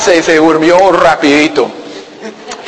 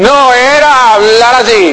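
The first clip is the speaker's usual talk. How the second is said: high, louder and slow; bold loud demo; 7 semitones higher, 3 dB louder than usual. Shown as a demonstration. Pastor showing how Marilyn really talked, high and slow. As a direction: belt it out like a brash talker.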